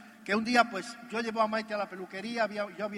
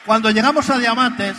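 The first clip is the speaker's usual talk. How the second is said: loud opening call; 13 dB louder than usual. Acted. Speaker to the convention crowd, loud call. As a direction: belt the opening line out to the hall.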